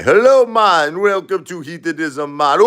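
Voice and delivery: high, loud Jamaican greeting; Jamaican accent. Retold or imitated. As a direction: welcome guests like a Jamaican resort worker. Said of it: "you know, Jamaican guy".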